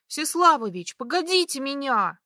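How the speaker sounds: high, loud, panting call; breathless shout. A narrator's reading. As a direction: call after him, gasping. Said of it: "крикнула я". (крик, задыхаясь)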